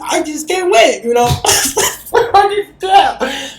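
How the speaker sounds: can't contain the excitement; giddy squeal. Real, not acted. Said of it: "I'm just a hopeless romantic".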